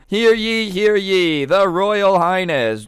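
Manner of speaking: high, drawn-out herald's proclamation; hammed-up royal fanfare call. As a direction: announce the king like a town crier. Acting squires heralding the king.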